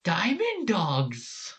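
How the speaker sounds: high, very drawn-out creature cry; Diamond Dogs. Acted. Fan imitating dog creatures, high elongated cry. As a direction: stretch the name out in a high call. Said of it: "I could try this one".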